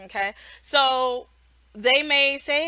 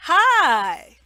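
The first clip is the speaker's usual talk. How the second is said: a cheery classroom hello, higher and louder; bright teacher greeting; 5 semitones higher, 8 dB louder than usual. Shown as a demonstration. Online teacher greeting a child, bright and loud. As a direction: greet the little student brightly. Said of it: "cup your ear".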